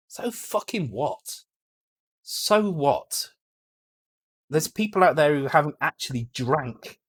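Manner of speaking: scornful, heated outburst; getting angry. Real, not acted. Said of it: "and I'm getting angry".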